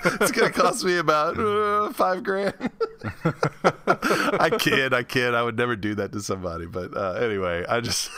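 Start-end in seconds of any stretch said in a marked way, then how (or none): 0.0-2.5 hammed-up punchline breaking into a laugh; mock indignation, amused. Joking imagined reply, cracking up. 2.5-5.3 high, laughing disclaimer; real laugh carried into the words. Real, not acted. Disclaims joke while laughing.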